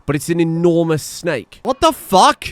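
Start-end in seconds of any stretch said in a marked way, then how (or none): 1.6-2.4 higher, louder shocked cry; shocked shout. Real, not acted. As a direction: yelp in disbelief. Lindworm drops dead instantly.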